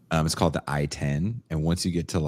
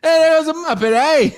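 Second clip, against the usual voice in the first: hammed-up Cockney chimney-sweep British accent, much higher and louder; over-the-top mock British accent with a laugh; 10 dB louder than usual. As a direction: do a Mary Poppins extra British accent. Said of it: "Why do I talk like Mary Poppins extras?".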